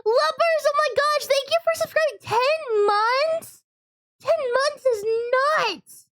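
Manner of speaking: very high, slow thrilled thanks; excited. Real, not acted. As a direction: gush with delight.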